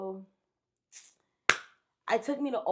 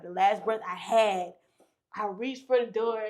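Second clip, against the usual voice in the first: usual speaking voice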